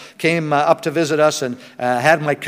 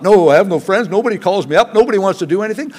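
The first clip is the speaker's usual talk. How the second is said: higher, louder whiny complaint; mock whining grumbler; 5 semitones higher, 6 dB louder, faster than usual. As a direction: whine like a lonely grumbler.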